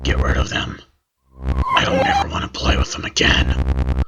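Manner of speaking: put-on deathbed whisper of a frightened boy; weary, desperate whisper. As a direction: whisper it like an old man on his deathbed. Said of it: "His voice was so weary and desperate".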